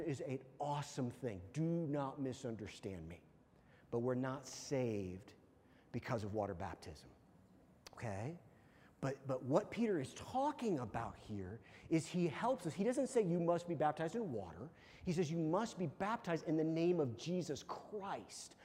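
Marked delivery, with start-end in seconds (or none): none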